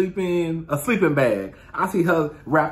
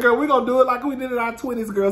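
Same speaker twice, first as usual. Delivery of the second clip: excited; 8 semitones higher, 3 dB louder, faster than usual. Retold or imitated. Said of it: "so we said".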